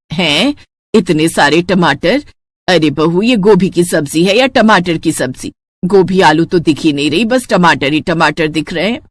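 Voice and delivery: put-on tired elderly woman complaining at the table; grumbling mother-in-law. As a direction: grumble like a fed-up saas. Mother-in-law sees tomatoes.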